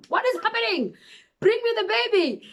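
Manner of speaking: put-on high, urgent plea for the baby; panicked demand. New mother pleading with staff for her baby, alarmed. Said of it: "I was like".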